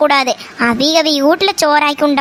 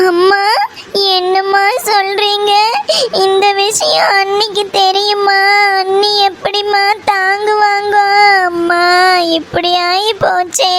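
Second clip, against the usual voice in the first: grieving wail. Acted. Daughter mourning the long-awaited baby, grieving wail. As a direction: draw out each phrase end in grief.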